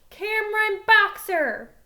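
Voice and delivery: loud, high, drawn-out shout of the name; yelling a name. Acted. Girl pounding on the door shouts his name. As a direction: call him out from outside the house.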